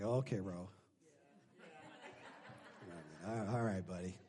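low, soft humouring voice; calm down someone mid-tantrum; quietly indulgent, imitating a patient reply; mock placating. God placating his tantrum.

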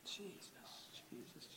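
soft whispered Jesus; whispered prayer. Real, not acted. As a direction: breathe the name in a whisper. Whispered prayer during praise.

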